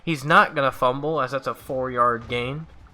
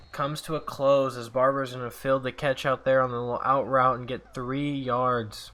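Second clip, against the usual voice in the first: ordinary talk, nothing marked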